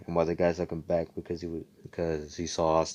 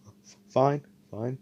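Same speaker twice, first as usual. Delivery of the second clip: higher, louder frightened giving in; nervous stammer; 6 semitones higher, 3 dB louder, slower than usual. Retold or imitated. Thief obeying Asta's order, nervously stammering. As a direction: stutter out a scared yes. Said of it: "And he's like".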